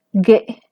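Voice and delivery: ge with a creaky glottal catch; glottalized ge. Shown as a demonstration. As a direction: say ge with a tight, creaky glottal closure. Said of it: "El número nueve".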